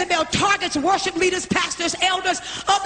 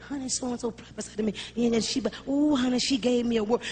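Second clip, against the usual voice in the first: much softer mock gossip, hushed honey talk; gossiping church member; 5 semitones lower, 7 dB quieter than usual. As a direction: pass on the rumour in a hushed put-on voice.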